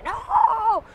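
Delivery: high-pitched tantrum cry; imitated child's angry refusal; aggressive little girl. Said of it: "muy agresiva".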